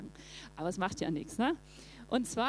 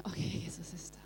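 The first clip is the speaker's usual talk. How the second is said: hushed, whispered relief; whispered thought; 3 semitones lower, 6 dB quieter than usual. Retold or imitated. Disciples' relieved inner thought.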